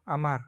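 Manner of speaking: अमार said smoothly as a-MAR with a trilled r; blended word. Shown as a demonstration. Teacher to class, blending syllables into a word. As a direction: join a, ma, r into अमार. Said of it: "आमार".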